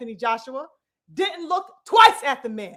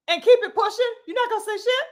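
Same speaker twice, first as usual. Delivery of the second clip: ordinary delivery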